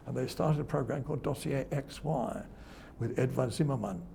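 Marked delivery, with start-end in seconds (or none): none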